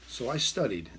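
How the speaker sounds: ordinary talk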